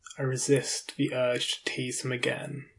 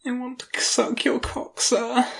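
pleading; 11 semitones higher, 5 dB louder than usual. Acted. Submissive begging answer.

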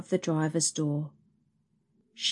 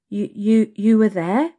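loud, high, shocked stammer; 5 semitones higher, 9 dB louder than usual. A narrator's reading. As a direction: stumble over the words, caught out. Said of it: "Gina stammered".

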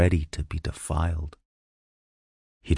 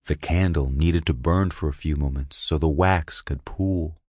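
ordinary delivery, nothing marked

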